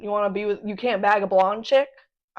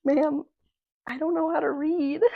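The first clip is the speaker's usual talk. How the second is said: high, small, sheepish voice; meek confession; 6 semitones higher than usual. Acted. Flustered patron confessing to the librarian, meek confession. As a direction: squeak it out as a bashful mock plea.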